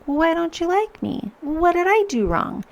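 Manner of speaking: high, plaintive mock whine; mock hurt whine. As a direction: play the wounded, needy self. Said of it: "It's super easy to be like".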